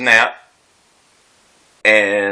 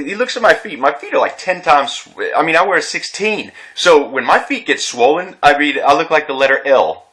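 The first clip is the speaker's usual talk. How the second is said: ordinary delivery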